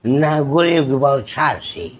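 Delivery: slow, measured put-on screen voice; drawn-out dramatic declamation; film-dialogue impression. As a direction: deliver a rhymed movie line with weight. Mimicry showreel, film-style dialogue.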